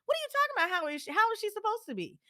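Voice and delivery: high, fast disbelief; retold indignant snap; indignant outburst. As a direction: demand it, incredulous. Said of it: "why would you ask her that".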